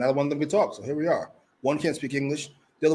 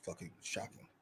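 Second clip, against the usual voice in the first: muttered sarcasm; 9 semitones lower, 20 dB quieter than usual. Real, not acted. Bored by Claudio's promo.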